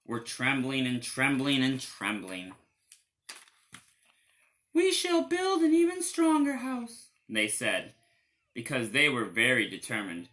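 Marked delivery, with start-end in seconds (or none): 4.7-7.1 very high small voices; tiny wolves deciding; squeaky little wolves. Acted.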